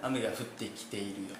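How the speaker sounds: prim, proper Tokyo-style 雨が降ってきているよ, softly spoken; teasing friend parodies polite Tokyo Japanese, softly; mock Tokyo standard speech. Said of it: "ボクサーとか言うんやろな".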